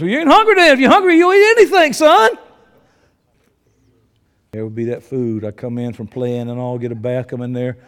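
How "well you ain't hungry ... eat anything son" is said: higher, louder, quicker dad retort; dad firmly telling his picky son he'll eat; stern dad. Retold or imitated. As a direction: bark it back at the fussy kid. Dad voiced retorting to child.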